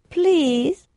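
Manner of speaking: high, pleading sexy voice. Retold or imitated. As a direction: coax it, seductively high. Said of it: "you know, in a sexy voice".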